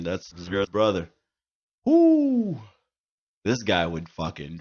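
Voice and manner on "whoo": excited whoop. Retold or imitated. Cheering about drinking friend.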